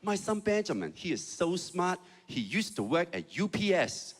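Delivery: put-on proud mother in a Chinese accent; bragging Chinese mom. Chinese mom bragging about her son's old job, Chinese accent. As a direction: boast about your son, Chinese-accented. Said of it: "My mom would be like".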